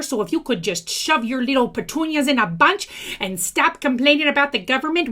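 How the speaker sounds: ordinary talk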